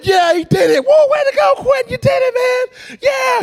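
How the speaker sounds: shouted, very high victory whoops; excited cheering. Retold or imitated. Kids jumping on the bed for Quentin, excited cheering. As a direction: cheer your friend on at full pitch. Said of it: "we're excited like we've done something".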